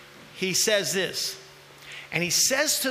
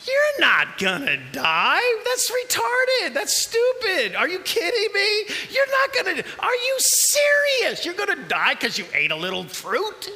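mocking serpent; 11 semitones higher, 4 dB louder than usual. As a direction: ridicule her fear like the snake. Preacher voicing the mocking serpent.

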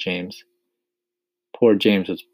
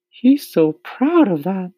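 high, slow ladybug voice; smiling ladybug; 13 semitones higher, 7 dB louder, slower than usual. A narrator's reading. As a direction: remark with an audible smile. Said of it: "smiling at James".

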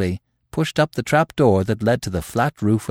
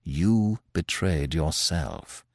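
slow, softer and lower; calm, hushed, slow verdict; quietly; 4 semitones lower, 7 dB quieter, much slower than usual. A narrator's reading. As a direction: say it quietly and slowly, without heat. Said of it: "said Manfred quietly".

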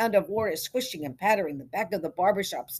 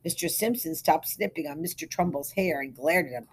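plain reading voice

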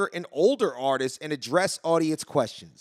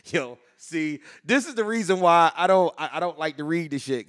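normal talking voice

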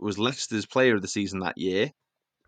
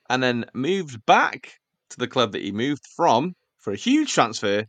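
ordinary delivery

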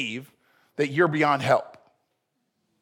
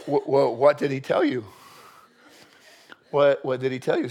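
lower, stammering nervous question; tense, hesitant; 4 semitones lower than usual. Acted. Saul asks Samuel what God said, nervously stammering. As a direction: stammer it out, suddenly subdued. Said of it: "you could almost feel the tension".

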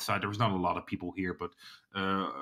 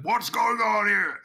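loud, drawn-out booming shout, pitched up; booming call; 12 semitones higher, 5 dB louder than usual. Acted. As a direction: boom it out slowly as you arrive. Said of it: "you hear this booming voice".

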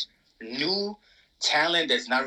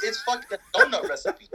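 laughing; 8 semitones higher than usual. Real, not acted. Laughing at the translation.

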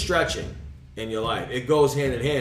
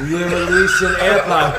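laughing mock; 5 semitones higher, 9 dB louder than usual. Mocking the air pocket line.

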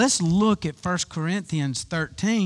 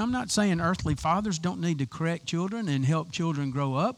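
ordinary delivery

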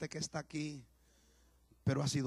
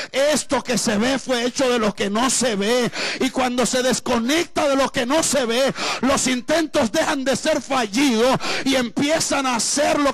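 loud, high, full-force sermon shouting; fiery preaching; 10 semitones higher, 16 dB louder than usual. Acted. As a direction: preach it hard to the congregation, voice up and loud.

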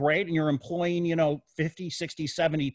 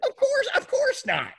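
emphatic denial. Real, not acted.

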